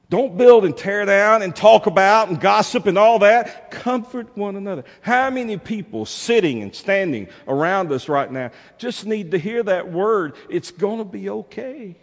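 high, impassioned exhortation starting very loud; preacher urging the flock; forceful preaching. Real, not acted.

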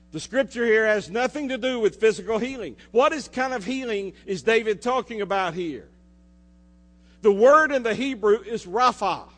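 fervent preaching. Acted. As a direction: preach it out to the congregation.

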